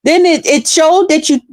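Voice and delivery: high, fussing worried voice; worried lady. Retold or imitated. Passenger relieved to see the car, high and fretful. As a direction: fuss over the driver. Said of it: "she said".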